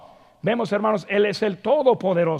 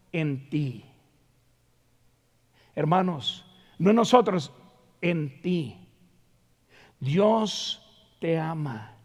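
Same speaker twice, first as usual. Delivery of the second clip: intimate; 5 semitones lower, 5 dB quieter than usual. Shown as a demonstration. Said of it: "Forma personal, íntimo".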